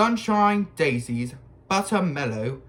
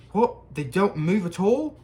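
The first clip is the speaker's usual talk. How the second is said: high, loud amazed question; 5 semitones higher, 3 dB louder than usual. A narrator's reading. Ron amazed that Muggle photos don't move. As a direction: sound truly astonished. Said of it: "sounding amazed".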